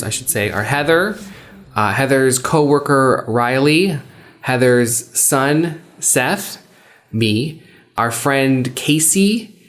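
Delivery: plain reading voice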